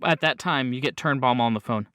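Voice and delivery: ordinary delivery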